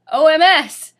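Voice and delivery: loud, high hammed-up cry. Vampire crying out in the orgy. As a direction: belt it out. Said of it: "cried Vampire".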